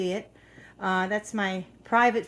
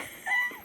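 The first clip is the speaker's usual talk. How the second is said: high quick giggle; 7 dB quieter than usual. Real, not acted. Quick laugh.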